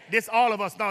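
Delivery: normal talking voice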